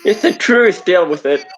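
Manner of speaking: loud, defiant comeback; calling back. Acted. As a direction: shout it back down to them. Calling back to hollering housemate.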